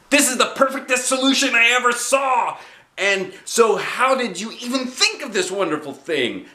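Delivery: put-on high, bouncy kid voice; little girl Junie B. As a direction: play a bossy six-year-old.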